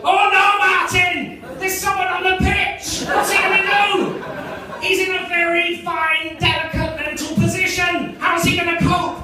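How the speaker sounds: high, loud, drawn-out Scottish commentary panic; alarmed sports commentator. Acted. Scottish co-commentator alerting Martin to a pitch intruder, hammed-up alarm. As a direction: call it like a crisis on the pitch. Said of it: "the commentator will kick in and go".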